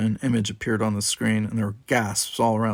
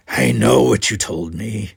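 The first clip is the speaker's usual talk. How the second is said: slow, louder retort; a defiant, louder comeback; shot back; 8 dB louder than usual. Acted. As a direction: fire it back at her. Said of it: "he shot back".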